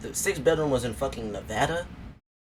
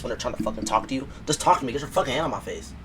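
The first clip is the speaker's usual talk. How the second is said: normal talking voice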